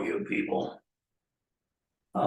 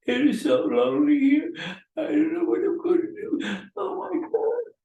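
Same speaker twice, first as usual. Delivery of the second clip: much higher, louder mock wail of loneliness; mock lonely whine; 3 dB louder than usual. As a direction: whine it up high like a pitiful child.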